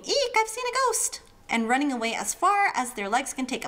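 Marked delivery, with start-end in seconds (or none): 0.0-1.2 high, squealing "Eek" of fright; mock scream. Describing a ghost fright, hammed-up high squeal. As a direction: play someone fleeing a ghost, squealing. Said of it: "like someone screaming".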